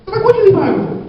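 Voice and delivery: very high mock-shocked mother; hammed-up alarmed question; shocked mom. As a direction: squeal it like a startled mom.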